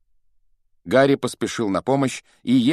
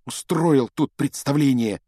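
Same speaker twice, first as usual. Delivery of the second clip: higher, accusing outburst; indignant exclamation; 6 semitones higher, 3 dB louder than usual. A narrator's reading. Frightened pupil blaming his classmate, indignant exclamation. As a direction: throw the accusation out, pitch raised. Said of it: "воскликнул тот".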